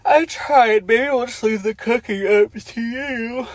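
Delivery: high, put-on sing-song voice; hammed-up high character read; mock character voice. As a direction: ham up the line in a silly high voice. Mocking a character's line.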